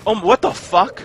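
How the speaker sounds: loud, high burst of disbelief; real startled outburst; shocked. Real, not acted. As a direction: blurt it out, alarmed and baffled. Shocked by noisy enemies.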